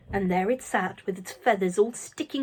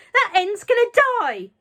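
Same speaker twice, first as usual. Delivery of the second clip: shouting; 12 semitones higher, 9 dB louder than usual. A narrator's reading. Grandma yelling.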